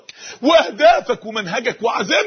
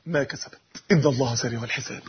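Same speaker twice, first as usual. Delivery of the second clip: much lower, softer, grave; hushed low close; 10 semitones lower, 7 dB quieter than usual. Acted. Preacher closing the verse to the crowd, low and hushed. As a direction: let it sink down low.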